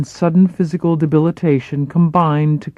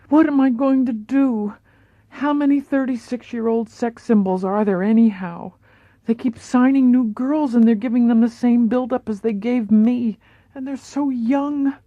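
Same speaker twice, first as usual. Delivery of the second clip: higher, worried starlet voice; anxious Marilyn; 8 semitones higher than usual. Acted. Marilyn to her acting coach, higher and fretful. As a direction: voice her fear of aging, pitched up.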